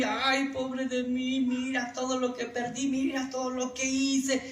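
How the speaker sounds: high, drawn-out tearful lament; hammed-up weepy complaint; mock self-pitying wail. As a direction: whine it out as if feeling sorry for yourself. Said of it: "entre más estés llorando".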